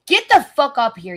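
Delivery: angry yell. Real, not acted. Streamer yelling at a stream troll.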